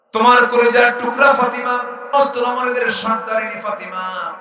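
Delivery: high, loud preacher's cry; preaching at full force. Acted. Preacher crying out to the congregation at full force. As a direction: lift the crowd with a loud call.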